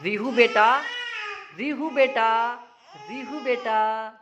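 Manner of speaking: high, loud call across the room. Real, not acted. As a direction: call her name so she turns. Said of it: "मैं बोलता हूँ". (बच्चे को ज़ोर से पुकारना)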